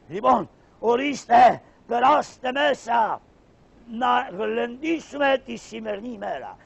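high, slow and loud welcome; high, loud slow call inside; loud invitation. Acted. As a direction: call everyone in to celebrate. Pasha invites all inside.

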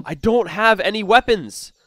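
normal talking voice

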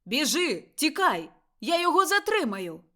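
loud, high desperate shout of a warning to flee; man shouting. A narrator's reading. Father shouting to Lily to grab the baby and run, loud panicked yell. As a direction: yell it to the wife with all your breath. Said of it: "кричав чоловічий голос".

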